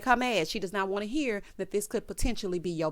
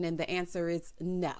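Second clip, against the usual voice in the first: ordinary talk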